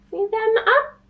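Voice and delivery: excited. Real, not acted. Pointing out picture to kids.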